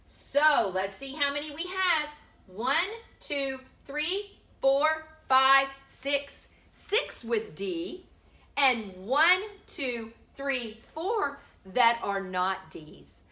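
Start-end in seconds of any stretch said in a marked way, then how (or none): none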